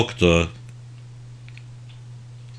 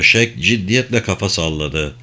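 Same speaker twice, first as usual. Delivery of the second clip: ordinary delivery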